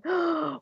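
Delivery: sharp gasp, out of breath; startled gasp. Retold or imitated. Mother retelling her labor gasps in shock. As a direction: gasp as the body takes over. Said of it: "almost out of breath because my body is going".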